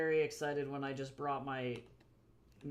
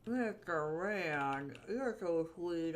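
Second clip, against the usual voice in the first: a slow, drawn-out coo to a pet; slower than usual. Real, not acted. Owner slowly cooing her cat's name. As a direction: stretch the name out lovingly.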